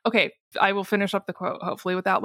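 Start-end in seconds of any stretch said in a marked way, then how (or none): none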